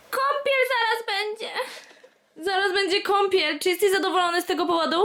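high teasing voice to the dog. Real, not acted. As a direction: tease the puppy about the bath. Teasing the dog about bath. (wysoki, przekomarzający się głos do psa)

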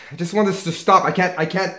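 rising to a loud, fast cry; desperate outburst. Acted. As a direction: despair breaking through. Man breaking down in despair.

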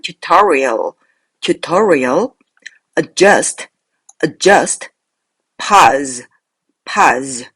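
"tutorial", "adjust" and "pause" each said singly and distinctly; careful model pronunciation. Shown as a demonstration. Teacher drills the words to watch, careful model pronunciation. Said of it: "주의해야 될 단어는".

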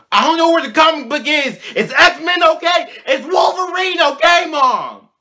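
high loud frantic yelling at mom; frantic shouting. Retold or imitated. Kid shouting at mom about his lost comic, frantic. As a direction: yell in a desperate panic. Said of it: "freaking out".

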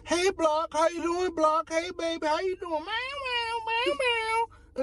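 high mock coworkers, then meowing cats; high greeting voices. Coworkers and cats greeting Block again, high mock greetings. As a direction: chirp it like everyone suddenly loves you.

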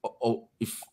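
usual speaking voice

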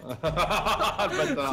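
said through laughter; laughing. Real, not acted. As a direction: laugh out the insult. Laughing at friend's scream.

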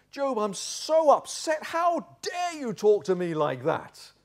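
high, slow indignant outburst; how-dare-you indignation, stretched out; mock outrage. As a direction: draw it out, hammed-up offence. Zophar acted as offended.

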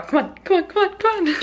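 high, eager hurry-up chant; excited squeaky hurrying along; impatient urging. Real, not acted.

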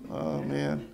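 usual speaking voice

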